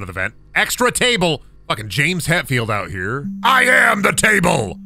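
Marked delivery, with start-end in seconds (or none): 3.4-4.8 loud, slow declamation; mock shout. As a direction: bellow it like a rock singer. Shouted joke line.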